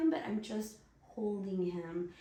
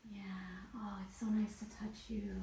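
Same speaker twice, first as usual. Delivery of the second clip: tender whisper; 5 dB quieter than usual. Real, not acted. Whispering to partner while touching.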